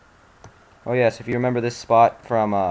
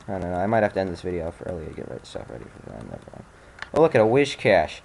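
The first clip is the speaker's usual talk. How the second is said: ordinary talk, nothing marked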